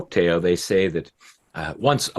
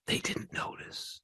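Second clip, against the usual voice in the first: whispered, suspenseful aside; hushed; 16 dB quieter than usual. A narrator's reading. Storyteller hinting at unseen danger, hushed whisper. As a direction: drop to a whisper.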